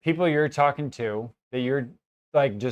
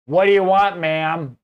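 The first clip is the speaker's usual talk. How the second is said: loud, higher, hammed-up Chicago accent; overdone Chicago accent; 4 semitones higher, 7 dB louder than usual. Showing off for an Australian customer, overdone Chicago accent. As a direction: lay the Chicago accent on thick. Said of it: "overdoing my accent".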